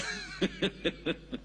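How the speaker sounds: light chuckle at own joke; a quiet, voiceless run of chuckles; amused, wheezy little laugh. Real, not acted. Laughs at own joke about lateness.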